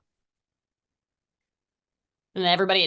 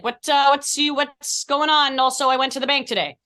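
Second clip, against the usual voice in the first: higher, louder ditzy cast-mate voice; imitating Kat; 6 semitones higher, 4 dB louder than usual. Actor imitating castmate Kat at a tired rehearsal, higher and louder. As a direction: play the lost actress mid-rehearsal. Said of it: "And Kat will be like".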